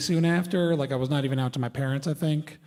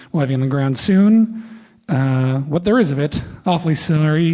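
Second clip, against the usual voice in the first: pilot voice; 9 dB louder than usual. Retold or imitated. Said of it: "this is a pilot voice".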